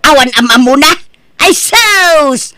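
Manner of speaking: loud, high, aggrieved outburst; upset ranting. Acted. Mother rants at her son that nobody helps her, loud and aggrieved. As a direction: let the hurt pour out as anger.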